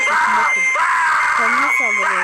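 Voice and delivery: loud shouted commentary; shouting. Real, not acted. Streamer shouting at the screen to viewers, loud. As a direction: yell it over the game noise. Shouting over the game video.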